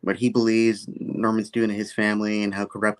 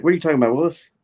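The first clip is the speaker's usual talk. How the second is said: higher, louder comic disbelief; mock incredulous; 6 semitones higher, 5 dB louder, much faster than usual. [name] reacting to Betty's news, mock incredulous. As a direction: play up the baffled catchphrase question. Jokey incredulous catchphrase reply.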